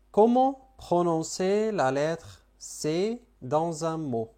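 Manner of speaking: very slow, careful French; slow French demo. Shown as a demonstration. Teacher reading the French question slowly to students. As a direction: spell it out slowly for learners. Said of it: "كيف نلفظ الحرف سي في كلمة؟".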